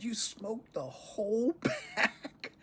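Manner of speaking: high, slow mock-stern dad; father's disbelief. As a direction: imitate dad asking in disbelief. Retold father questioning son.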